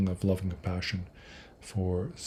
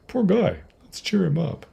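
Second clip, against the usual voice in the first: high, pitying sing-song, much higher; mock pity; 9 semitones higher, 4 dB louder than usual. We pity the crying Geshe, high mock sympathy. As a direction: coo it like pitying a sad friend. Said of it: "We think".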